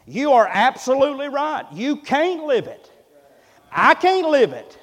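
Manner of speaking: high, loud pulpit declaration; emphatic preaching. Real, not acted. Preacher answers those who say they can't, emphatic.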